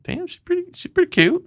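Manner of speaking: high, hushed admiring damn; high mock awe. Recalling his thought about the woman beside him, high and hushed. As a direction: squeak out the compliment. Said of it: "And I'm like".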